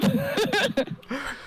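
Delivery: high-pitched burst of giggles. Real, not acted. Laugh after blooper.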